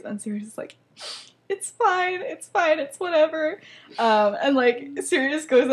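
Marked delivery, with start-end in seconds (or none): 1.5-3.6 high, mock-wounded brush-off; pretending to be sad. Sirius answers James's sympathy, fake-sad and high. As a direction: play hurt, badly hiding it. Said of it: "Sirius pretends to be all sad".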